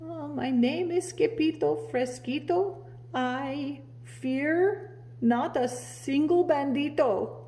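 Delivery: high, small trembling voice; a timid little hero trying to be brave; muy soft voice. Acted. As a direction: squeak it out bravely though scared. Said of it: "in a muy, muy soft voice".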